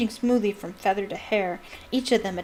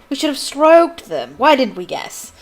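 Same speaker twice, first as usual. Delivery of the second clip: higher, louder mocking jeer; Malfoy jeers at Hagrid's lesson, higher and louder; sneering; 5 semitones higher, 8 dB louder than usual. A narrator's reading. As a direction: jeer at the teacher, loud and high. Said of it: "Malfoy sneered".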